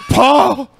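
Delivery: high, loud "Paul?" in disbelief; surprised call. Retold or imitated. Scotty hearing that Paul thinks he's mad, high surprised call. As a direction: call his name as if shocked. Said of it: "And then Scotty's like".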